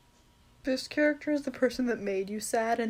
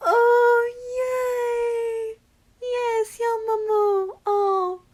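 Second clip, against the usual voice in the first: high, drawn-out happy cooing; gushing delight; 10 semitones higher, 4 dB louder, much slower than usual. Real, not acted. Fan thrilled to get a beloved character, gushing delight. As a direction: coo over a favourite.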